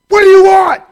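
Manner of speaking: high, loud bark; screaming drill staff. Retold or imitated. Drill staff yelling at a cadet, loud high bark. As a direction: bark it like a furious drill instructor.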